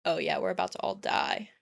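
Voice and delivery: ordinary talk, nothing marked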